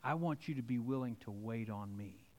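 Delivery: hushed divine voice calling you to wait; soft voice of God. Acted. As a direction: speak as God, quietly and tenderly. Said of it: "God says".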